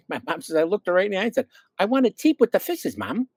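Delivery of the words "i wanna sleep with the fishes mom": put-on high little-kid voice with baby talk; imitated little kid. Little kid explains smelt under the pillow to mother, childlike. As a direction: plead like a small child, high and lisping. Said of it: "when I was a little kid".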